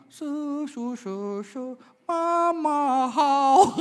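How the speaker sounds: slow, stretched, high wail of Mama how; hammed-up tearful singing; mock wailing. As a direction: howl it like a weepy ballad. Crying to a sad song.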